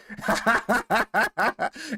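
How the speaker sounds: high cackling run of laughter; laughing. Real, not acted. Host mocking the husband's complaint, laughing. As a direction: crack up at your own sarcasm. Laughs at own sarcastic line.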